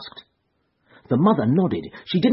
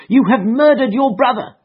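much higher and louder accusing wail; mother cries out at her daughter in despair; despairing cry; 12 semitones higher, 7 dB louder than usual. A narrator's reading. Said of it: "cried Missus Charpentier".

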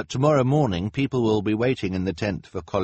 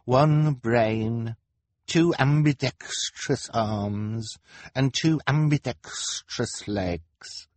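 slow, higher-pitched lament with a gasp; sad self-pity; 4 semitones higher, much slower than usual. A narrator's reading. Kevin lamenting his ambidextrous body, mildly sad. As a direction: list each one drawn out, mildly despairing. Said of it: "Kevin said sadly".

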